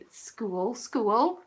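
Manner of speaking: "school" stretched into two syllables; odd regional pronunciation. Shown as a demonstration. Mimicking for viewers how they pronounce it, two-syllable "school". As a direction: say "school" as skoo-ul, rising. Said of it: "They pronounce school in the weirdest way".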